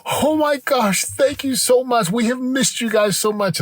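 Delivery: high-pitched gushing thanks, retold; tearful gratitude. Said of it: "tears welled up. And she's like".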